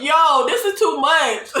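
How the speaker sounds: playful protest tipping into giggles; protest, then burst out laughing; laughing exasperation. Real, not acted. Mock complaint ending in laughter.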